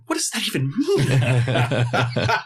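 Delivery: slow, laughing bewilderment; laughing confusion. Retold or imitated. Couple puzzled by the crew, slow laughing question.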